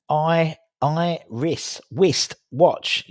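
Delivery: slow "I-rist-whist-watch", each piece separate; broken-down syllables. Shown as a demonstration. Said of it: "See, yeah, you're right".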